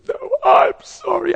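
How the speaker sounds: high, slow wailed insistence; high-pitched tearful protest; imitated crying apology. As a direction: wail it slowly like a crying wife. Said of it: "she's just crying".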